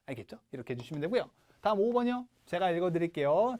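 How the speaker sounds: ordinary talk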